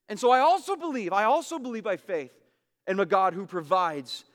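high, loud, fervent preaching; fervent pulpit delivery. Real, not acted. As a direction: press the conviction home. Preaching at full force.

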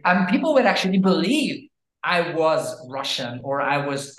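ordinary talk, nothing marked

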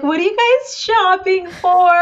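high, eager mock excitement; all excited. Saleslady greeting mother and daughter shopping for a dress, all excited. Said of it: "like, all excited".

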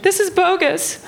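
very high mock mom voice; high-pitched imitation of mom; indignant mom. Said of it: "She's like".

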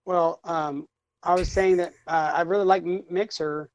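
halting, with long pauses and fillers; struggling for words. Real, not acted. Put on the spot by co-hosts, halting and unsure. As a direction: fumble along, losing the thread. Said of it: "can't get the words out".

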